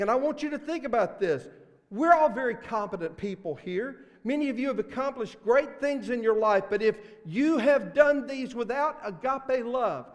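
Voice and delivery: preacher building up the verses, loud; full-force preaching. Acted. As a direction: drive each line home to the congregation.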